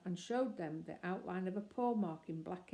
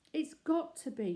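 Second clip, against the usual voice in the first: higher, louder hopeful outburst; eager cry; 9 semitones higher, 5 dB louder than usual. A narrator's reading. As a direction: blurt it out, desperate to be right. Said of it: "cried Jonathan".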